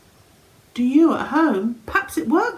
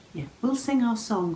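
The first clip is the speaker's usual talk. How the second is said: ordinary delivery, nothing marked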